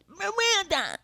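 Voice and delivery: "Miranda" as a very high "Muh-wanda"; goofy high-pitched "Wanda" cry for "Miranda"; silly mispronounced name. Retold or imitated. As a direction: squeal "Miranda" with a w for the r. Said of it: "That's not my fucking name".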